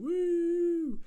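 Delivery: high, long held whoop; excited woo. Real, not acted. Reviewer greeting the bonus song with a high whoop. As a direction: let out a drawn-out high "woo".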